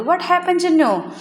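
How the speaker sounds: high-pitched storytime question; lightly acted mother checking on her crying child; concerned mother asking. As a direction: ask it high, as a mother to her little one. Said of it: "ചിന്നുവിനോട് ചോദിക്കുകയാണ്".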